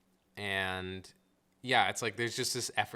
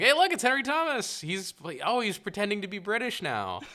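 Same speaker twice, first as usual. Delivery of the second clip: high, loud "hey, look!" exclamation; mock excited recognition; 10 semitones higher, 5 dB louder than usual. Viewer spotting the actor behind the role, mock excited. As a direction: call out a mock discovery, pitched up high.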